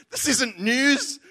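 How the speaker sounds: slow, said through real laughter; amused, laughing disbelief. Real, not acted. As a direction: laugh as you say it. Laughing at absurd airport footage.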